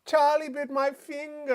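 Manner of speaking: put-on high, loud British child whine; British kid. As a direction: stretch it out like an aggrieved British toddler. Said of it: "I think it's a British kid though".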